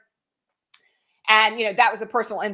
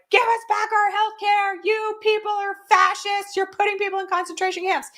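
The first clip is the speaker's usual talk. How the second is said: high, insistent imitated shout; retold shouting; 11 semitones higher than usual. Friend yelling at Republicans on parade float, imitated high shout. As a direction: imitate an outraged protester yelling, pitch held way up. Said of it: "my friend was shouting".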